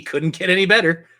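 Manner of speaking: normal talking voice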